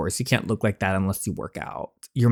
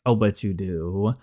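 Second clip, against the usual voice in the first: slower, lightly voiced smug line; a touch of sly teasing; 3 semitones lower, 3 dB quieter than usual. Girl teasing her dad about who hides in her bed, sly and slow. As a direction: savour "Oh, but you do." as a sly tease.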